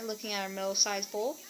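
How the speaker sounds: ordinary delivery, nothing marked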